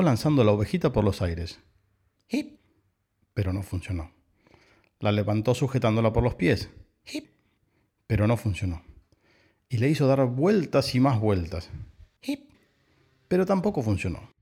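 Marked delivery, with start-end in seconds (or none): 2.3-2.6 tiny high hiccup squeaks; imitated hiccups. A narrator's reading. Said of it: "le entró hipo". 7.0-7.3 tiny high hiccup squeaks; imitated hiccups. A narrator's reading. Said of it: "le entró hipo". 12.2-12.5 tiny high hiccup squeaks; imitated hiccups. A narrator's reading. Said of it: "le entró hipo".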